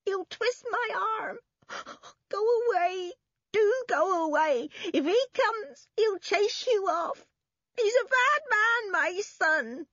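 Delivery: put-on very high, wailing old woman; weeping. A narrator's reading. Old woman begging children to leave, weeping. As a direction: plead in a high tearful wail. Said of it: "she wept".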